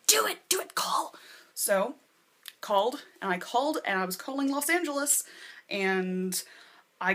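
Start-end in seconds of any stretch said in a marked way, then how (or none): 0.1-1.2 whispered high, pushing her on; urging whisper. Retold or imitated. Susan urging her friend to call Los Angeles, whispering. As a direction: egg her on under your breath. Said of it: "Susan was like".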